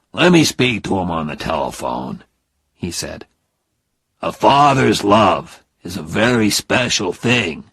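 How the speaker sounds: lightly voiced fatherly earnestness; a hint of a father pleading for family; earnest father Phil.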